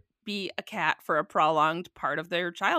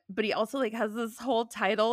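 normal talking voice

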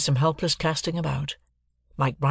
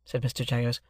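plain reading voice

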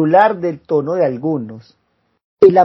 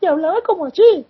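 much higher, thin mock speaking voice. Friend mimics a famous singer's interview voice, high mock voice. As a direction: mimic the singer's surprising talking voice. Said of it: "daba unas entrevistas".

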